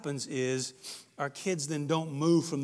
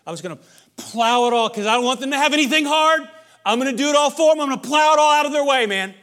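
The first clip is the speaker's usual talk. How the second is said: much higher and louder, pushy bravado; mock snowplow parent; 11 semitones higher, 16 dB louder than usual. Parent boasting about clearing the kid's way, loud mock bravado. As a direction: belt it out like a doting parent bulldozing every obstacle. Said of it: "snowplow parent just plows every hard thing".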